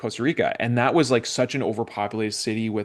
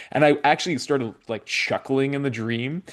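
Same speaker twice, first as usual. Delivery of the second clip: ordinary talk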